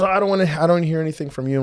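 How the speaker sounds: brushing off. Retold or imitated. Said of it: "He goes".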